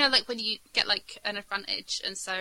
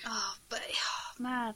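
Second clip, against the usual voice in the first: whispered; 7 dB quieter, much slower than usual. Real, not acted. Disappointed groan.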